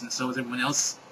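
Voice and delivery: ordinary delivery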